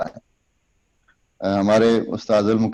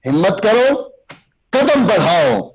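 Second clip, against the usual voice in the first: high, emphatic speech call; rousing exhortation; 6 semitones higher, slower than usual. Shown as a demonstration. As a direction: urge the crowd on. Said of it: "دونوں ہاتھوں کا استعمال".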